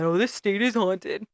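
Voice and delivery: slow mocking sassy echo. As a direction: mimic her sass, drawn out. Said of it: "a whole lot of sass and attitude".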